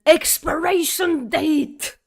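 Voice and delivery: loud, high indignant shout; angry exclamation. Retold or imitated. Telling the woman off in the store, a loud shout. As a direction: shout it in outrage. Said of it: "I was mad and I said".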